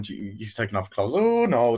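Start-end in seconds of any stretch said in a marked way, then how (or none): 1.1-1.8 flustered. Retold or imitated. Flustered protest.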